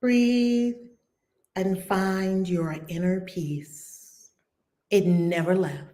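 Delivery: hushed prayerful chant of peace; guided prayer. Acted. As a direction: lead them into calm, slowly with long pauses. Leads prayer meditation.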